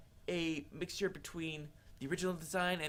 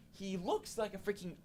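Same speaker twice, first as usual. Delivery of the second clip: ordinary talk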